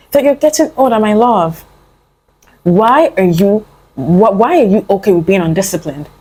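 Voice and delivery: normal talking voice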